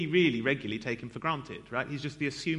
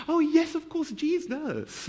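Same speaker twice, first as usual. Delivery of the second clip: much higher sing-song mock piety; mock pious switch; 13 semitones higher, slower than usual. Preacher mocking his sudden churchy voice, pitched high. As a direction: suddenly go all holy and high. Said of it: "then you're like".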